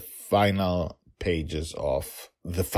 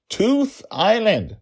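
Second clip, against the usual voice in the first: high, slow, loud correction; insistent child; 8 dB louder, much slower than usual. Acted. Daughter correcting her dad, slow exasperated insistence. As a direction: spell it out loudly for a dense dad.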